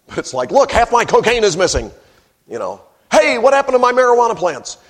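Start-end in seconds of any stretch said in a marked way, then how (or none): 0.5-1.9 loud, higher indignant complaint; mock drug caller. Mock caller reporting stolen drugs. 3.1-4.8 loud, higher indignant complaint; mock drug caller. Mock caller reporting stolen plants.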